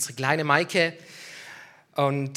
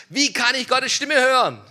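loud, higher retold demand; imitated shout; 6 semitones higher, 7 dB louder than usual. Man coming forward to ask the pastor, imitated shout. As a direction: call the question out insistently, like the man shouting.

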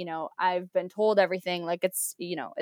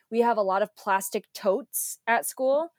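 normal talking voice

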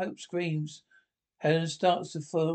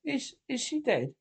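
hint of hope; 4 semitones higher than usual. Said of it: "with a hint of hope".

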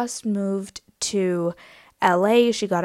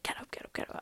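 hushed, urgent whisper; whispered get up, get up; 16 dB quieter, faster than usual. Retold or imitated. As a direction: whisper it like a bouncer sneaking up. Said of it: "He's like".

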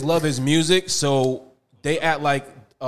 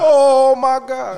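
much higher, louder, slow "Oh my God"; excited exclamation; 12 semitones higher, 12 dB louder, much slower than usual. Real, not acted. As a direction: stretch it out in loud delight. Reacting to sound button.

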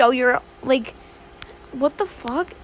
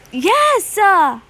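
a sudden high, loud yes; excited yes; 8 semitones higher, 7 dB louder than usual. Real, not acted. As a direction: pop it out as you remember. Remembers she did it.

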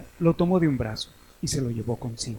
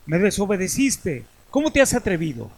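sharp, raised scolding voice; 5 semitones higher, 5 dB louder than usual. Acted. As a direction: rebuke him, louder and higher. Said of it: "increpó".

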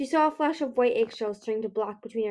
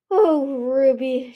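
loud, slow, drawn-out condescension; mocking amusement; 3 semitones higher, 8 dB louder, much slower than usual. A narrator's reading. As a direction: stretch it out as if pitying a fool. Laughing queen mocks her daughter.

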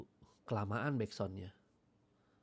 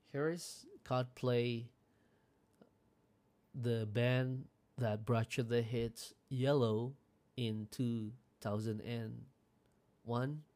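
slow, deliberate radio announcer read; DJ song intro; much slower than usual. Shown as a demonstration. Radio trainer demos a DJ intro for new hosts, slow announcer voice. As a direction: announce the track like an on-air DJ. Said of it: "gitu maksud gue pakai tahun".

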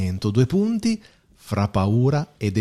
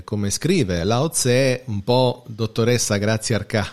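ordinary talk

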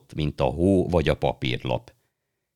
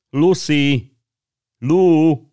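high, loud calling out a name; boy shouting for his sister; excited shout; 13 semitones higher, 9 dB louder, much slower than usual. Acted. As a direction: call out eagerly into the dark. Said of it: "kiabálta izgatottan".

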